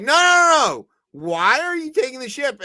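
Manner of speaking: frantic protest. Retold or imitated. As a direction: play the world shouting in disbelief. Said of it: "they were like".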